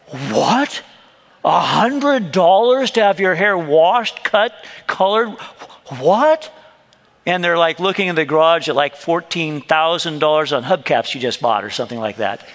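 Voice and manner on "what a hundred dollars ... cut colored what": mock shocked husband. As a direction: play the stingy husband, aghast at the price. Husband shocked at wife's request.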